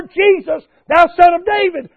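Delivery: high, loud desperate appeal. Retold or imitated. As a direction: raise the voice and cry for mercy. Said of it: "he cried out even the more".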